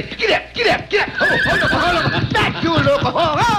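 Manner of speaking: high, hammed-up bandit yelling at his horse; lightly voiced bandit urging the horse on; bandit shouting. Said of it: "The bandito jump on his horse and say".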